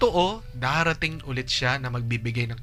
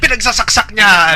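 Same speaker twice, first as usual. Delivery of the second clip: loud, high storyteller's outburst; dramatic shout; 7 semitones higher, 13 dB louder than usual. Retold or imitated. Host acting out a story that jumps straight to the climax, shouting. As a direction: blurt the shocking climax out loud. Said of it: "Hindi yung agad nandun ka sa climax".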